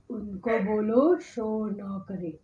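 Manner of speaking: slow, low, thickened possessed voice; भूत की मोटी आवाज़. Retold or imitated. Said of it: "आवाज मोटा हो गया". (धीमी, भारी, भूतिया आवाज़)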